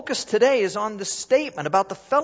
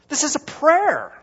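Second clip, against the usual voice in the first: emphatic; 10 semitones higher, 7 dB louder, slower than usual. Real, not acted. Preacher's climax.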